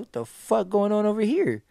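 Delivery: high, loud alarmed question; alarmed shout. Retold or imitated. On tour, spotting people running in dark, alarmed shout. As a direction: call out, startled by the chaos.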